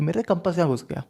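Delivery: deadpan and soft as if it were trivial; deadpan calm. Retold or imitated. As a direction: say it like nothing happened, straight-faced. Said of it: "मैंने इसी tone में कहा है ये बात".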